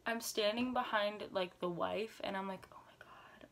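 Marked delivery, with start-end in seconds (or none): 2.7-3.5 tiny whispered aside; stunned whisper. Retold or imitated. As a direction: whisper it under your breath in disbelief. Hiding laughter behind the wife.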